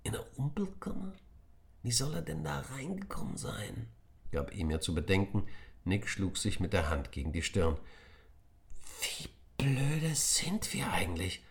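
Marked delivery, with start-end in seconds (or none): 0.0-3.9 higher hushed whisper; doubtful, kept quiet. Acted. Emir doubts Nick at the storeroom door, whispering. 9.0-11.4 slow, hushed self-reproach; whisper, annoyed at yourself. Acted. Nick scolds himself outside the storeroom, whispering.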